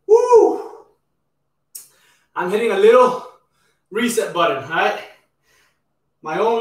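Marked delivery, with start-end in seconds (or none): 0.1-0.9 high loud whoop; excited whoop. Real, not acted. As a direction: whoop to push through. Cheer after set.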